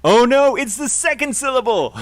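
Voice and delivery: high, loud sudden surprise; surprised oh no. Real, not acted. Teacher realizing she was wrong, surprised and loud. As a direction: catch your own mistake aloud. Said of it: "discovers her mistake".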